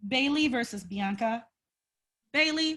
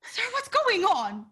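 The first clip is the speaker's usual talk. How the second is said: mock concern; 11 semitones higher than usual.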